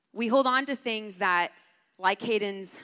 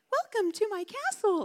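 imitated toy laptop sound; 13 semitones higher than usual. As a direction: mimic a broken kid's toy laptop talking. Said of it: "it goes like".

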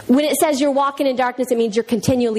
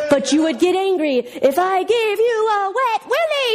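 high, slow cartoon villain taunt; hammed-up villain, pitched up; evil mastermind; 7 semitones higher, slower than usual. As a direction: tempt the hero with drawn-out mock menace. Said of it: "he gives all these scenarios".

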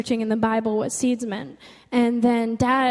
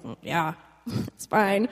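mock-reluctant low, quiet yes; hesitant consent; 3 semitones lower, 4 dB quieter, slower than usual. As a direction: agree softly with a little laugh, unsure. Hesitant consent to stranger's prayer.

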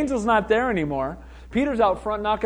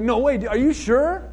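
mock disbelief with a rising question; incredulous reaction; 3 semitones higher, slower than usual. Other pastor hearing of the healing, incredulous. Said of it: "then I called the other pastor".

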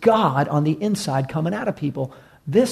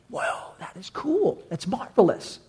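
much higher, soft, slow awed whoa; marveling; 4 semitones higher, 6 dB quieter than usual. Retold or imitated. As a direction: breathe out the wonder slowly. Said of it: "others say they marveled".

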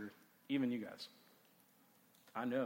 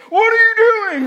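shrill shocked yell, much higher and louder; dad yelling; 21 dB louder, slower than usual.

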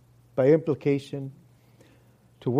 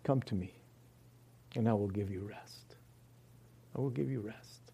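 tender promise; 6 semitones lower, 13 dB quieter than usual. Acted. Quoting Jesus's promise of rest.

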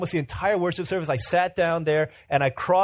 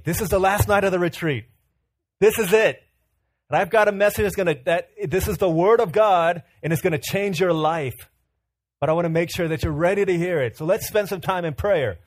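louder, hammed-up preacher buildup; mock fiery preacher; 4 semitones higher, 6 dB louder than usual. Pastor stalls his congregation with a louder, mock-fiery preacher build. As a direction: oversell the big message to buy time.

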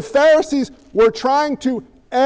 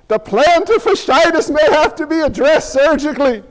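pleading prayer voice, higher and louder, hammed up; mock desperate prayer; 4 semitones higher, 3 dB louder than usual. Preacher mimicking a bargaining churchgoer, pleading higher and louder. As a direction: beg God like a panicked bargainer.

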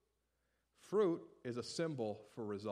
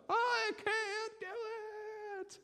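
mock despairing cry; much slower than usual. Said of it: "screaming at God".

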